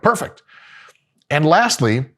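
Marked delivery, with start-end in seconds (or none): 0.0-0.4 sarcastic perfect. Retold or imitated. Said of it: "And you're like".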